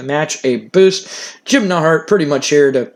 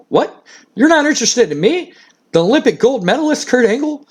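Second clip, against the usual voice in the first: higher-pitched mock incredulity; indignant disbelief; 8 semitones higher than usual. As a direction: play a wrestler stunned at being turned down. Acting Kurt offended at being rejected.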